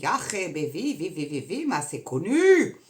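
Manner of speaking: put-on German accent. Joking to viewers about Nazis, in a mock German accent. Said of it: "Pareil que mon accent allemand".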